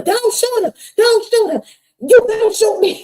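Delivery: put-on very high, loud mother shielding her child; desperate shout. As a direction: scream it, throw yourself before the gun. Mama shouts, protecting her.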